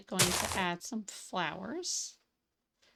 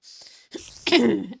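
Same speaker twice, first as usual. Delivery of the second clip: loud throat clearing; 2 semitones higher, 13 dB louder than usual. Real, not acted. Voice giving out.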